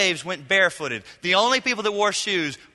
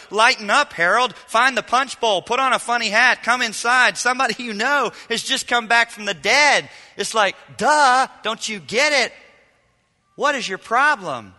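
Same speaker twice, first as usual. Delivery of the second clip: higher, louder, exasperated cajoling; cheerful father; 5 semitones higher, 6 dB louder than usual. Pretend. Father urging the older brother inside, mock exasperated. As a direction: coax the sulking brother to the party. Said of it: "The father says to the older brother".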